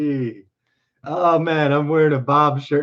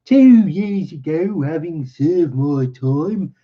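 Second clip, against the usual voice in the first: higher, performed voice for the tale's teller; story narration; 5 semitones higher, 3 dB louder than usual. Acted. Engineer telling his strange story to his listeners, narrated in character. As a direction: read it out in character as the visitor. Reads visitor's tale in story voice.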